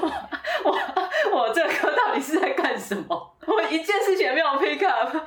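high, loud, laughing through the words; laughing disbelief. Retold or imitated. Retelling the wasted English class, laughing loudly. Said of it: "然后我就觉得".

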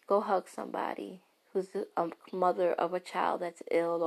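ordinary talk, nothing marked

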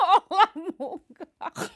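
giggling out the question; laughing. Real, not acted. Teasing her twin sister, laughing through it.

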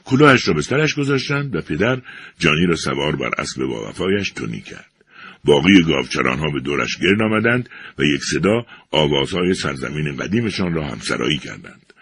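ordinary delivery

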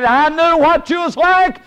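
high, loud indignant complaint; angry Jonah. Acted. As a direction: rail at God like a sulking prophet. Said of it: "And Jonah said".

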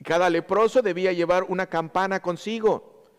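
usual speaking voice

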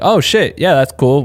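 high, bright "oh wow" reaction; impressed surprise. Retold or imitated. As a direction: sound pleasantly caught off guard. People reacting to his email.

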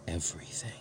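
soft whisper with a rise. Acted. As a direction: breathe the word as a tease. Whispered prompt after request.

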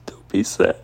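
choked, high sobbed words; real crying. Real, not acted. Repeating an ex's hollow reassurance, crying hard. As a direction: break down while repeating empty comfort. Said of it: "I'm a very emotional person".

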